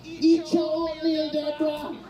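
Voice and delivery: high, slow, drawn-out caregiver yell; mock nurse scolding. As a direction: bark it like a bossy nurse at breakfast.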